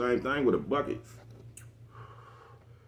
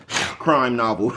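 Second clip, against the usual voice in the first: laughing; 2 semitones higher, 6 dB louder, much slower than usual. Real, not acted. Cracks up at own joke.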